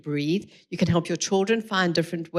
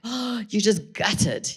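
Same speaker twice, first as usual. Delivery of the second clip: mock gutted; 3 semitones higher, much slower than usual. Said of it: "Your emotions are like".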